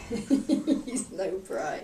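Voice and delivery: laughing. Real, not acted. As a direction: laugh the words out slowly. Client laughs repeating phrase.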